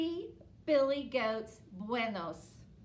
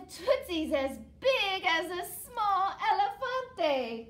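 put-on lively read-aloud for children; storybook reading; 4 semitones higher, 9 dB louder than usual. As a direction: tell it to the kids, bright and steady. Reads picture book aloud.

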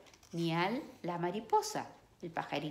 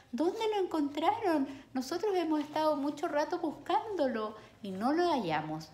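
put-on high, eager child's voice; excited kids asking; siblings asking; 9 semitones higher, 3 dB louder than usual. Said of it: "le preguntan". (niños preguntando con voz aguda)

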